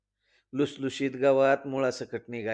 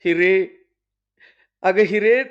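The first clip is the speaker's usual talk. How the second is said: loud urgent call; aunt yelling a name; shouting for Hira; 4 semitones higher, 7 dB louder than usual. Acted. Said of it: "ठक्की मावशी वरडली".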